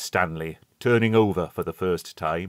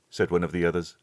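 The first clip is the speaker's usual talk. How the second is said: plain reading voice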